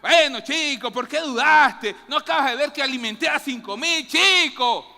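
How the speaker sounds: loud, exasperated rebuke; mock angry scolding. Jesus scolding Peter the way people imagine, loud and angry. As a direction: scold him like a fed-up boss. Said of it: "como bravo".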